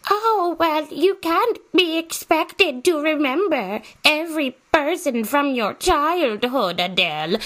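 high, slow, prissy mock voice; high mocking imitation of a know-it-all viewer. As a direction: lecture me in a mocking singsong. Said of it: "You're probably like".

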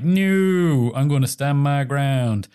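high, slow, drawn-out refusal; imitating a stubborn politician; mock defiance. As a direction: dig your heels in, hammed up. Retold minister refusing to resign.

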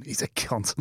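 whispered, voice dropped right down; conspiratorial whisper. Retold or imitated. Co-host whispers the barber's insult about a fighter. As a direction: hush it like passing on gossip. Mock-confiding the barber's gossip.

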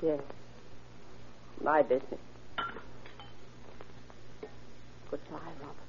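low, hushed and drained, almost to herself; ominous quiet. Acted. Mother quietly saying goodbye to her son at breakfast, hushed and ominous. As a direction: murmur a final goodbye he must not catch. Mother's eerie farewell before shooting.

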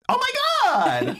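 very high, drawn-out squeal; Aaron. Acted. Teasing a co-host with an impression, high squeal. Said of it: "an impression of Aaron".

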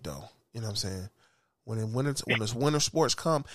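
ordinary talk

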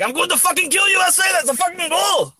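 hammed-up fan ready to fight; mock enraged fan. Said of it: "People, like, pull guns out".

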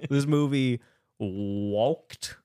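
very slow, stretched delivery; mock-dramatic build-up. Host building up to the movie joke, slow mock-dramatic. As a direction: draw out WALKED for suspense.

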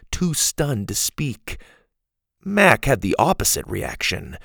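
ordinary delivery

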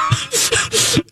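high, breaking laugh; laughing till it squeaks; high burst of laughter. Real, not acted. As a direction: crack up helplessly. Laughing at the memory.

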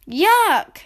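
high, loud cry of disgust; disgusted yuck. A narrator's reading. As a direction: spit the word out after a bad taste. Sister tastes the stew.

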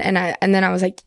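ordinary talk, nothing marked

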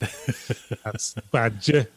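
slow, drawn-out Yorkshire "Badger"; Yorkshire accent. Shown as a demonstration. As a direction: stretch "That's Badger" in broad Yorkshire. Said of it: "said in a Yorkshire accent".

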